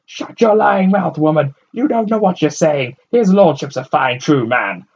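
loud, high furious rebuke; angry rebuke. A narrator's reading. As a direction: shut her up in anger. Man angrily defends Stark.

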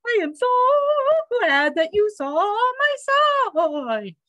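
high, trilling sing-song; chirping bird. A narrator's reading. Bird teacher delighted the dog saw her sign, chirping high. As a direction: chirp it high with swooping, stretched vowels. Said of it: "the bird chirped".

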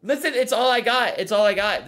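metallic, boxy mic sound; tin can voice. Real, not acted. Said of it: "sounds like he's in a tin can".

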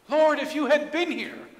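high, loud, grieving accusation; angry reproach. Acted. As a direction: throw the blame at him in anguish. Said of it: "Mary and Martha were angry with Jesus".

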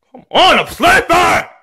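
high, drawn-out protest; annoyed yell. Acted. Woken child yells at parent.

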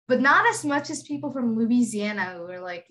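ordinary talk, nothing marked

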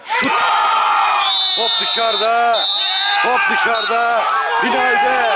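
high, loud goal-call roar; excited shout. Acted. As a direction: roar it like the winning moment. Winning penalty missed, team through.

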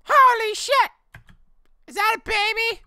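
very high, loud screech; shrill screechy voice. Acted. Gamer voicing a shocked friend, very high screech. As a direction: screech it up at the top of the voice. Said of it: "that actually hurts my vocal cords".

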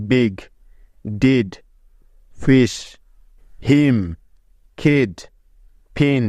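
বিগ, ডিড, ফিশ, each said slowly and apart, English short i sound; English example words. Shown as a demonstration. Teacher reads বিগ, ডিড, ফিশ to students, one by one, English short i. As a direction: read each word from বিগ to পিকচার on its own, a pause after each, with the English short i. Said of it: "যেমন".

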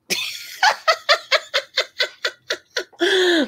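long very high wheezing laugh; high laughing fit. Real, not acted. Vlogger dissolving into a long high giggle fit.

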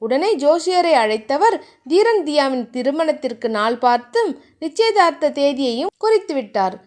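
ordinary delivery, nothing marked

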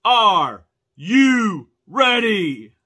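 loud, slow, drawn-out arena hype; ring announcer. Acted. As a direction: work up a wrestling crowd. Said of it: "become a ring announcer".

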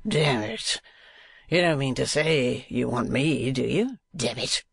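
affected dandy drawing out his words; mincing, put-on gentleman voice. A narrator's reading. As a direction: play the familiar, hearty fop. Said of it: "uttered in a mincing tone".

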